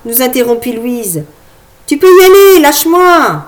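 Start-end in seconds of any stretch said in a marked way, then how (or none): 1.9-3.4 put-on child's voice. Little girl shoos her dad.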